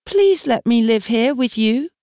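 put-on high, pleading young girl's voice; begging girl. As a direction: plead like a desperate teenage girl. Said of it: "Julie had begged Martha".

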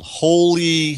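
high, loud yell up at the stars; retold yell. A kid seeing stars for the first time yells. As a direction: shout it at the top of your lungs, retold. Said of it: "at the top of his lungs, he yelled".

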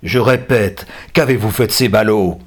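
loud, forceful demand; violent captor. A narrator's reading. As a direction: demand it menacingly. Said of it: "effrayée par la violence de son ravisseur".

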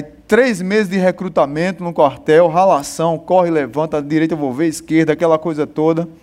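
ordinary delivery

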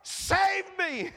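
high desperate cry to the Lord. A narrator's reading. Peter sinking, crying out to the Lord, high and desperate. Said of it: "he cries".

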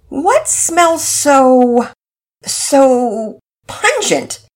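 high, very slow, drawn-out question hunting for a word; searching father. Acted. Daddy Peters enters sniffing the smoke, slow and searching. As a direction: sniff the air and grope for the word.

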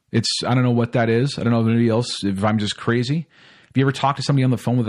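ordinary talk, nothing marked

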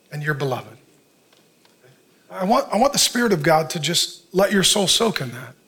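usual speaking voice

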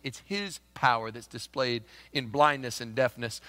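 usual speaking voice

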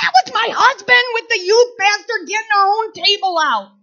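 high loud laughing outcry; exasperated shout. Retold or imitated. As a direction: shout out the silly truth. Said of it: "I'm like".

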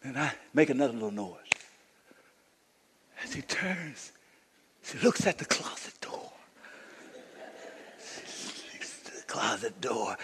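very soft, near-whispered narration; hushed suspense storytelling. Real, not acted. Preacher telling of the girl nearing the closet, hushed and suspenseful. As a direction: draw out the suspense, barely above a whisper.

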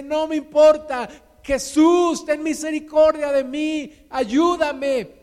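high, loud cry for help; defiant shouting. Acted. Blind beggar ignoring those hushing him, defiant shouting. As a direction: keep shouting, refuse to be silenced. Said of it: "Y sigue gritando".

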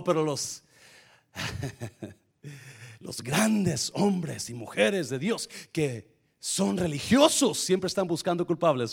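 normal talking voice

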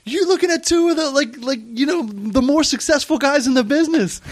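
high, puffed-up Bert impression; mock boasting. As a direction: brag about being the successful guys. Bert bragging to her.

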